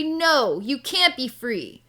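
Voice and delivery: loud, drawn-out mock scolding; bullying refusal. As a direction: shut the plea down like an angry bully. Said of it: "He's being a total bully".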